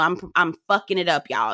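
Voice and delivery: ordinary delivery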